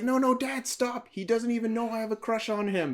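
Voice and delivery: put-on high, pleading teen girl voice. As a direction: beg dad to stop, voice pitched high. Girl begging dad to stop.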